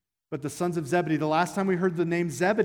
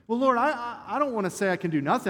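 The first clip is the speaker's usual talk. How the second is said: higher, louder whiny objection to the Lord; mock-indignant protest to the Lord, higher and louder; 6 semitones higher, 4 dB louder than usual. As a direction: plead your case like an indignant child.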